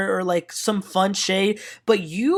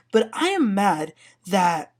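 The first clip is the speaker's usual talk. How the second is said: ordinary talk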